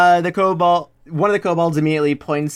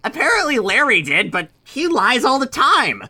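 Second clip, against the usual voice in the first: kobold dismissive; 6 semitones higher than usual. Acted.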